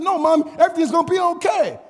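put-on high-pitched kid standing firm; confident little kid. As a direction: answer Mom like a sure child. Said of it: "he's, he's confident".

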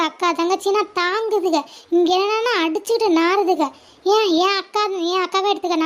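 normal talking voice

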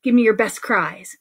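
usual speaking voice

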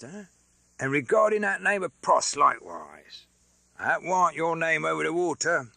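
lightly voiced rough Londoner; Mister Cruncher, hoarse. A narrator's reading. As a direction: blurt in as a blunt, hoarse fellow.

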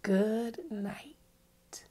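soft unvoiced good night; whispered goodnight. Teacher closing the bedtime book, whispering good night. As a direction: whisper it as they fall asleep.

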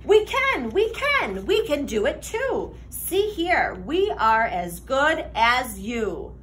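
ordinary delivery, nothing marked

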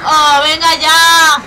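loud, slow, drawn-out groan of protest; exasperated shout. Real, not acted. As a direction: bellow it, fed up. Fails again, exasperated. (grito exasperado)

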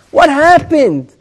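What happened? stretched in reproach; preacher lamenting, pointed and reproachful; indignant demand. Acted. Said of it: "the person changes his tone of voice".

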